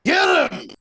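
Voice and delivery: hollered sideline command; put-on rowdy soccer shout; sideline yell. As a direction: yell it out at the players. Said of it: "what do you yell while".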